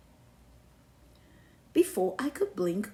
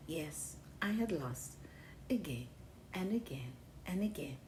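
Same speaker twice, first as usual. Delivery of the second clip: quiet resignation; 5 semitones lower, 8 dB quieter than usual. A narrator's reading. Calm resignation after losing.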